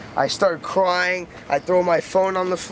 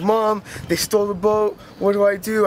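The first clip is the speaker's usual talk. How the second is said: panicked plea to mom; 4 semitones higher than usual. Retold or imitated. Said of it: "I'm, like, crying, screaming".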